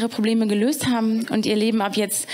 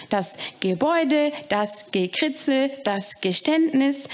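eager listing; 3 semitones higher, slower than usual. Acted. Little Marsin reciting nouns to the caretaker, slow and eager. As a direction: count off each word carefully.

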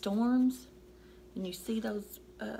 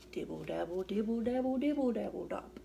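plain reading voice

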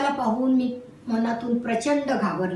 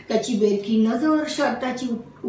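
ordinary delivery, nothing marked

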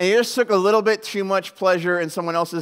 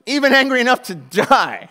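loud, high tantrum outburst; hammed-up defiant fury; angry retort; 7 semitones higher, 9 dB louder than usual. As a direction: protest like a petulant little kid. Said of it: "Jonah retorted".

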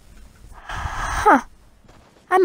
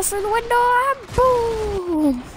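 excited; 8 semitones higher, slower than usual. Real, not acted. Aiming rocket.

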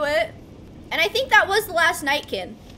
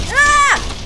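loud high shriek of fright; startled scream; 10 semitones higher, 8 dB louder than usual. Real, not acted. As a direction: jump and scream. Jump scare.

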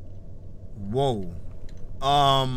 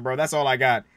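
ordinary talk, nothing marked

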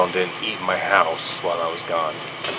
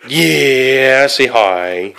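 excited with a dog; 5 semitones higher, 9 dB louder, much slower than usual. Real, not acted.

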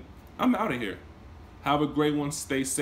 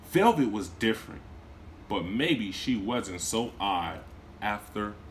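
gentle read-aloud storytelling; storybook narrator voice; story narration; 2 semitones higher than usual. A narrator's reading. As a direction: read it to a class of children. Teacher reads story aloud.

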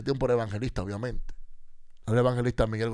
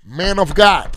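loud, high call of Man of God; a housekeeper calling loudly through the door; 7 semitones higher, 15 dB louder, much slower than usual. Retold or imitated. Housekeeper calls the preacher through the hotel door, loud and high. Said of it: "fue una housekeeping, una afroamericana, y me tocó la puerta".

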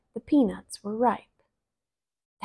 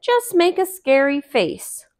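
Brer Rabbit; 5 semitones higher, 5 dB louder than usual. Acted. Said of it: "said Brer Rabbit".

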